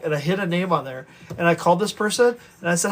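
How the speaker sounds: ordinary talk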